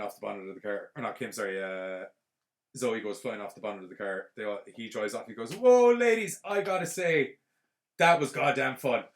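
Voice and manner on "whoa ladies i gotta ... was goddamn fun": loud whoop settling into emphatic delight; imitated thrill-seeker's shout; gleeful holler. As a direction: call out like an exhilarated daredevil. Acts out Mike's gleeful shout afterwards.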